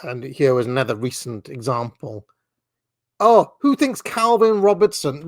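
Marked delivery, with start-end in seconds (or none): 3.2-5.3 louder, higher mock-eager post reading. Host reads an engagement-farming post about Calvin Robinson, mock eager. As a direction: read the bait post with fake enthusiasm.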